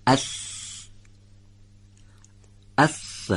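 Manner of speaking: slow isolated syllables of س, each held apart; teacher sounding out letters. Shown as a demonstration. Teacher to class, sounding out syllables slowly. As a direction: drill the syllables اس، س، سن، سی، سو one by one. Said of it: "دقت کنید".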